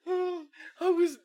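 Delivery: high squealing laughter; cracking up helplessly; laughing. Real, not acted. Cracking up at the video.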